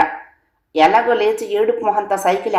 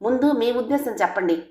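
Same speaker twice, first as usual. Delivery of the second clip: insistent; 5 semitones higher, 5 dB quieter than usual. A narrator's reading. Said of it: "అంది పట్టుదలగా".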